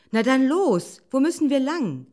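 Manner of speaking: loud, high, pushy fox; urging. A narrator's reading. Fox urging the wolf onward, loud and pressing. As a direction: press your companion to get going. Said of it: "drängte der Fuchs".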